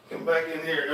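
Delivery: ordinary delivery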